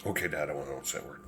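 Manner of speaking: put-on very soft, low, compliant kid reply; retold kid's hushed, low answer; meek agreement. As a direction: murmur a dutiful promise to dad. Child agrees to keep secret.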